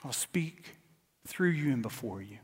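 soft, hushed voice of God; quiet reassurance, almost whispered; preacher voicing God, hushed and calm; God speaking softly. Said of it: "God says".

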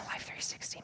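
whispered aside, barely voiced; whispered grumble. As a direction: grumble it under the breath in a whisper. Muttered first reaction to mom's warning.

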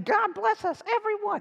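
put-on high, childlike little-boy voice; high-pitched Tiny Tim. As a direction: squeak it out like a small boy. Quoting Tiny Tim's famous line.

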